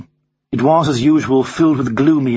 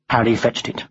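usual speaking voice

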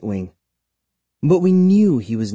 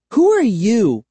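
high, loud, forceful question; insistent, raised-voice challenge; demanding; 11 semitones higher, 5 dB louder than usual. A narrator's reading. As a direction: demand an answer sharply. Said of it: "Kara demanded".

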